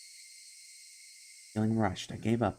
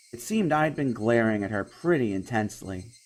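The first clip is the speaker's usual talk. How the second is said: usual speaking voice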